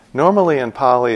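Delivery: ordinary talk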